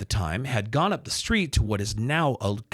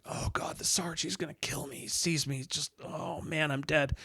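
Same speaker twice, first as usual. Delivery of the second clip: frightened whisper; panicked whisper; 4 semitones higher, 7 dB quieter than usual. Retold or imitated. New DJ sees the boss staring through the window, terrified whisper. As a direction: hush it, sure the boss will kill you. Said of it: "I'm like".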